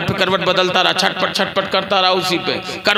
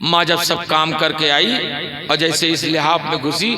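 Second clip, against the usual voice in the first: ordinary talk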